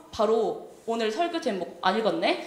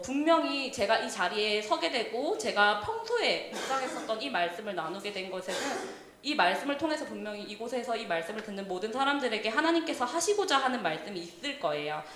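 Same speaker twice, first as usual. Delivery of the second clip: ordinary talk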